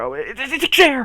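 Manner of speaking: high, loud stammered blurt; mock-frantic rising exclamation; sudden outburst. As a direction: burst out with the word at last. Mock word search ends in outburst.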